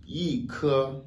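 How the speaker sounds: "i ke" with a high falling "i"; fourth-tone yi. Shown as a demonstration. Teacher gives first-tone example, "i" falling in "i ke". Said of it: "le i devient automatiquement quatrième ton".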